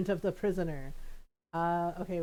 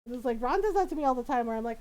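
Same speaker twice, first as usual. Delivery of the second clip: ordinary talk, nothing marked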